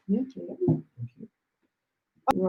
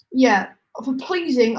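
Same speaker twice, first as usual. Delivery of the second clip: ordinary talk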